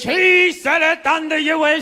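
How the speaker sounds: lightly hammed-up solemn preacher, slow; a hint of a priest intoning old English; mock preacher old English. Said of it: "speaking in old English".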